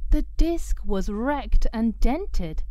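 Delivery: usual speaking voice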